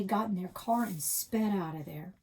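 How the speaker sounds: usual speaking voice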